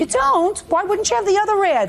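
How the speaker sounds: high, loud incredulous scolding; sarcastic scolding tv judge. Real, not acted. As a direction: scold him, disbelieving and sharp. Judge scolding litigant over missing ad.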